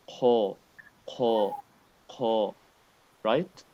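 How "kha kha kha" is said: Kha said heavy with a full mouth; Kha repeated with a full, round mouth. Shown as a demonstration. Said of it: "it's with a full mouth".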